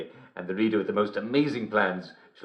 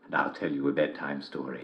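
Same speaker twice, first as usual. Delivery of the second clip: usual speaking voice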